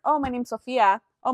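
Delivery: usual speaking voice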